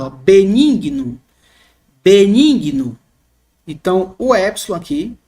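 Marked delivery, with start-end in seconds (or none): 0.1-3.0 BENIGNO said slowly, first E held long; drawn-out long E. Shown as a demonstration. Teacher showing students the long Greek E, drawn out. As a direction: stretch the E in Benigno. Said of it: "se torna mais longo, mais demorado".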